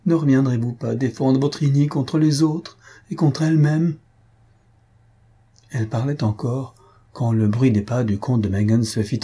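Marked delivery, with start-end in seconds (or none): 0.1-4.0 mildly distressed, higher-pitched appeal. A narrator's reading. Faustine alone calling to Anastase's portrait, mild distress. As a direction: implore an absent protector, understated. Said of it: "d'une voix pleine de détresse".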